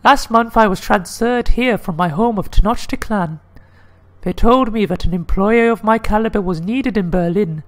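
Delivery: high-pitched put-on lady's voice; high falsetto woman. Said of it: "I get to do the lady voice".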